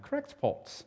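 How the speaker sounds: "Cracked pots" in a high, soft mock British accent. Shown as a demonstration. Pastor jokes to his congregation in a mock British accent. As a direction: toss off "Cracked pots" like a quick British joke. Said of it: "you need to say that with a British accent".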